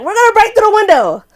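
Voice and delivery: high, loud acted announcement; urgent call. Acts boyfriend calling out plan.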